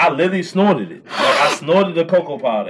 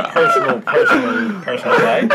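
laughing; 5 semitones higher than usual. Real, not acted. Laughing, repeating word.

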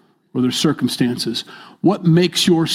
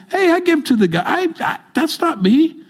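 much higher, protesting voice of a rich believer; defensive rich man; 13 semitones higher than usual. Retold or imitated. Preacher imitating a generous rich believer protesting, high and defensive. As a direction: squeak out a defensive denial. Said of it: "He's like".

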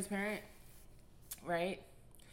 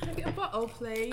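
high, louder put-on British; mock British accent; 4 semitones higher, 5 dB louder than usual. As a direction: playfully try a British accent. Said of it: "in the British accent".

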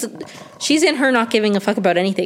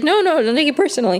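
quick high mock reassurance; 7 semitones higher, much faster than usual. Lottie reassures Travis after sex.

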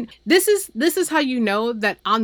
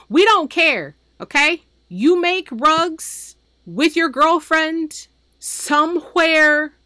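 louder and higher, fed up with him; telling him off, voice raised; exasperated rant; 6 semitones higher, 4 dB louder, slower than usual. Real, not acted. Ranting at Turner.

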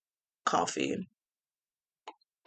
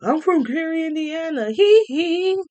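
imitating a breathy high celebrity voice, ending in a hee-hee; 7 semitones higher than usual. Mocking a singer bragging about his hometown, high sing-song voice. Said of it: "He didn't say".

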